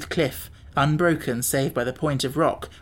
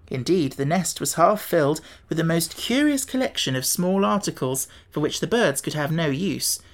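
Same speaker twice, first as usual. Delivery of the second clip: ordinary delivery, nothing marked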